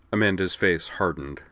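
usual speaking voice

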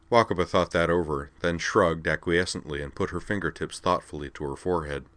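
ordinary delivery

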